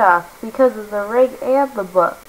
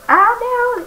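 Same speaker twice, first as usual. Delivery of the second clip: high alarmed cry of warning; alarmed shout. Acted. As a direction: yelp it out, much higher. Alarm at spotting enemies.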